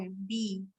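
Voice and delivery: "बी" with the ee drawn out; long vowel. Shown as a demonstration. Teacher demonstrating an open syllable, "बी" with long ee. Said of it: "हम लोग खींच रहे हैं ना ई का sound".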